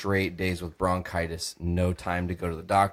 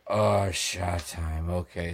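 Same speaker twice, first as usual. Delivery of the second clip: slow, drawn-out, lower echo; slow dazed echo; 3 semitones lower, 5 dB quieter, much slower than usual. Retold or imitated. Answering the roommate's call with slow drawn-out delight. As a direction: savor it slowly, half drunk. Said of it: "And I'm like".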